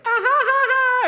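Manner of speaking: squeaky high fake anime laugh; cutesy shrill girlish giggle, loud. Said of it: "they're just like".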